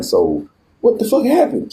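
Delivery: high, slow baffled question; incredulous. Retold or imitated. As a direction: demand the story in disbelief, drawn out. Asks Steve what happened.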